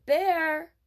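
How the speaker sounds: loud, high calls for the bear; yelling. Acted. Boy calling for a bear in the woods, yelling. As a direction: shout it out into the woods. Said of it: "he kept yelling".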